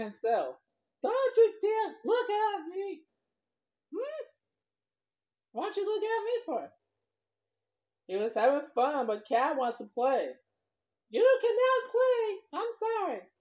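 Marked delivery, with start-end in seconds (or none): 1.0-6.7 angry fish. Acted. Fish scolding the cat. 11.1-13.3 high, slow fish voice shouting the refusal; angry fish. Acted.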